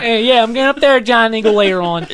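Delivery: high, loud yinzer; Pittsburgh accent. Pretend. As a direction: tell Debbie you're off to Giant Eagle, full yinzer. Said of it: "And it's like".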